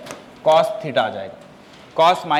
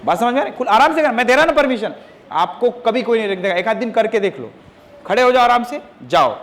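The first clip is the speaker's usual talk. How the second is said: higher-pitched, insistent telling-off; real scolding; 5 semitones higher than usual. Real, not acted. Teacher to pupil asking to leave class, scolding. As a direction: lay down the rules crossly.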